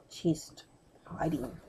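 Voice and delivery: ordinary delivery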